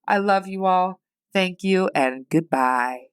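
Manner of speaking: ordinary talk, nothing marked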